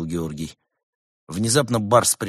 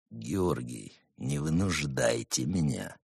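menacing whisper; 8 semitones lower, 7 dB quieter, slower than usual. A narrator's reading. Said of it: "прошептал брат Немрод".

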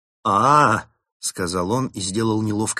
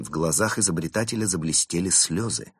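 usual speaking voice

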